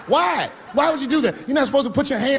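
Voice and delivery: high, shouted disbelief; shocked dad. Retold or imitated. Father shocked his son punched a girl, high shouted disbelief. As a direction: squeal out how could you.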